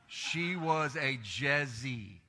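She was a Jezzy, very slow with Jezzy stretched and stressed; slow, knowing repeat. Acted. Pastor repeats to the congregation, slow and pointed. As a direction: lean into Jezzy slowly.

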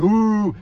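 loud mocking ooh from the crowd; a hammed-up playground ooh. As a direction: kids jeering ooh. Crowd reacting to shove.

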